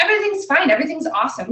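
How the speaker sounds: high-pitched put-on positivity, easing down; mock everything's-fine cheer. Parent faking that all is well for her child, mock cheer. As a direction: chirp it like a parent pretending.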